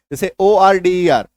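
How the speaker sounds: ordinary talk, nothing marked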